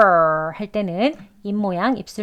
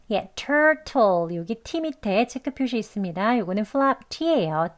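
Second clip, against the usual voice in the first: normal talking voice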